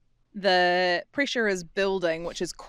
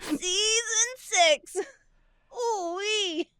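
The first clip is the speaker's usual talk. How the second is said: very high, drawn-out strained squeak; clenched throat; 11 semitones higher, much slower than usual. Shown as a demonstration. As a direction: squeeze it slowly out at the top of the voice. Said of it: "Clenching up".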